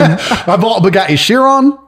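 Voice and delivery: slow, chuckling through the words; laughing brag. Real, not acted. Guest brags about his Bugatti, laughing through it.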